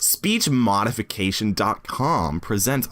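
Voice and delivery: slow, exaggerated American announcer; mock American accent. Streamer parroting pronunciation clip's American woman, slow mock American accent. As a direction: imitate an American woman's voice-over, slowly. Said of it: "This is an American woman".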